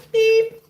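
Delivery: high, loud answering-machine beep; mock beep. Streamer imitates the voicemail beep. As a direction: squeak out a beep. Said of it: "Please leave a message after the beep".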